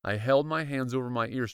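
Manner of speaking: ordinary delivery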